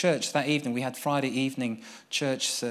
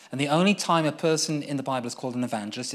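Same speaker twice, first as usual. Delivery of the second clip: usual speaking voice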